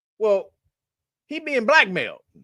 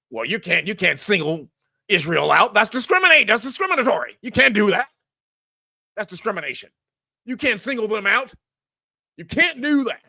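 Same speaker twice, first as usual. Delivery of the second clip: mock official scolding; 3 semitones higher, 4 dB louder than usual. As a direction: lecture them like a prissy bureaucrat. Mocking the administration's rule.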